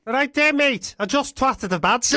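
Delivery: lively Scouse impression; Liverpudlian accent. Shown as a demonstration. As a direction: greet a pal in broad Liverpool accent. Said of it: "in Liverpudlian, what would that sound like".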